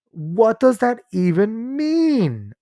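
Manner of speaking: very slow, high disbelief; incredulous. Real, not acted. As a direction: drag it out in disbelief. Mocking the quote.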